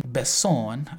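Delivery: "Besson" in French, rising at the end, stress on "-son"; playful French accent. Shown as a demonstration. Enjoying the name in a mock French accent. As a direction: savor "Besson" with a French lilt. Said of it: "having fun with the accent".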